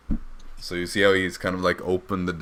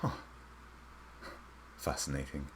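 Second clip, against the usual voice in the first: hushed, private musing; whispering to himself; 3 semitones lower, 13 dB quieter than usual. Acted. Eli alone after letting go of the orb, hushed self-talk. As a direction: murmur it softly, for your own ears only. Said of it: "Just kinda whispers to himself".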